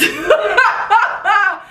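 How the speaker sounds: real burst of laughter, high; bright real laugh at her own theory. Real, not acted. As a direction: laugh out loud at yourself. Laughing at her own wrong guess.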